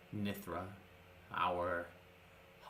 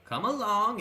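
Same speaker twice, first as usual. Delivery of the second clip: beckoning carriage voice; 10 semitones higher, 7 dB louder than usual. Acted. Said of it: "And it goes".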